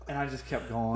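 ordinary talk

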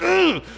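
sharp high yelp of fright; startled yelp. Retold or imitated. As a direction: yelp like the clocks just scared you. Acting Pete scared by clocks.

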